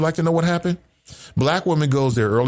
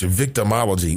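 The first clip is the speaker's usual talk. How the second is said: usual speaking voice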